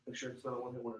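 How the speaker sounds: low, barely audible throwaway line; unenthusiastic salesman. Shown as a demonstration. Trainer mimicking a dull sales rep, unenthusiastic. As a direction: murmur it with zero conviction. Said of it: "Is that showing your belief and your conviction".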